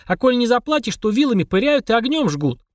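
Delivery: put-on high, earnest child voice; a little girl scaring him; young girl voice. Girl continues her tale.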